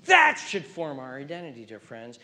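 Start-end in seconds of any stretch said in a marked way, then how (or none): none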